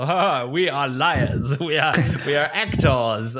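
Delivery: slow, hammed-up gloating liar; lightly hammed-up mock confession; mock villain confession. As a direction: play a cartoon villain owning up, laughing. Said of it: "You went straight to the whole".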